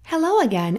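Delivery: usual speaking voice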